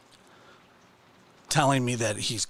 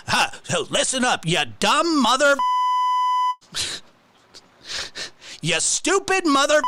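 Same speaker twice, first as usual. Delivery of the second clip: mock tough insult; 11 semitones higher than usual. Mimicking the streamer's insult to the narrator, in a high jeer. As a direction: sneer it up high like a wannabe tough guy. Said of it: "he does this thing where he goes".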